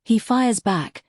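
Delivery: ordinary talk, nothing marked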